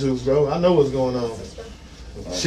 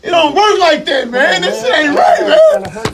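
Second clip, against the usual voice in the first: crying shout; 9 semitones higher than usual. Real, not acted. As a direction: scream it through tears. Shouting and sobbing, restrained.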